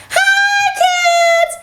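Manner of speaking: high shouted hello; shouted greeting. Shown as a demonstration. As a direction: yell it out to the kids. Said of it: "first we'll say hi".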